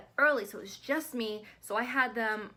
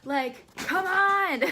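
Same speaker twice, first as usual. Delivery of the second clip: higher, louder, slow mock yell; retold yell for husband; 8 semitones higher, 7 dB louder, much slower than usual. Retelling calling her sick husband for help, a hammed-up yell. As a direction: call upstairs, dragging out "come on". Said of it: "I'm screaming".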